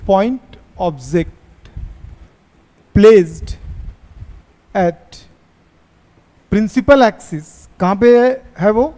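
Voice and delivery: very slow, word by word, for copying notes; slow dictation. Shown as a demonstration. Teacher dictating to the class, very slow and drawn out. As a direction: spell it out slowly so students can write. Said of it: "dictating notes slowly to class".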